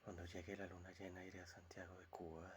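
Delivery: very soft, breath-only recitation; whispered verse. Acted. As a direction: recite it under your breath. Poet recites a remembered verse quietly.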